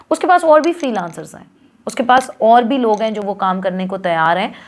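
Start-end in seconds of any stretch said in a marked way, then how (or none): none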